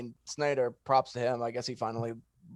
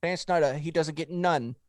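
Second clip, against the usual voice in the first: lively mock British accent, louder and a touch higher; hamming up a British bloke with mate and all; put-on accent; 3 semitones higher, 6 dB louder than usual. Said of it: "that was a great accent".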